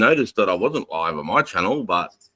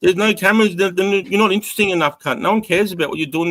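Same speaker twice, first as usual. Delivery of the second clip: exasperated; 9 semitones higher than usual. Real, not acted. Arguing back at conspiracy claim.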